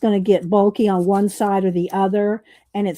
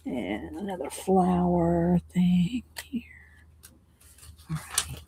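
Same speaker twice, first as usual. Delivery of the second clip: whisper; 7 dB quieter, slower than usual. Real, not acted. Murmured aside while working.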